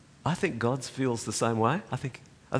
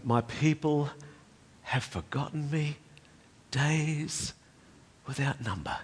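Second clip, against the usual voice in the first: voice of the Lord; 4 semitones higher, 4 dB quieter than usual. Acted. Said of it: "where the Lord says".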